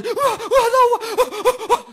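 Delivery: high, loud hammed-up child panic; mock panicked wailing. Panicking child wailing at the father, high and loud. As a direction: wail like a kid in a panic, laughing.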